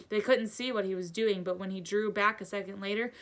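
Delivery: ordinary delivery, nothing marked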